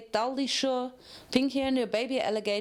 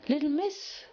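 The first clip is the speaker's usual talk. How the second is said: drowsy; 6 semitones higher than usual. A narrator's reading. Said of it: "Cheerily drowsily answered".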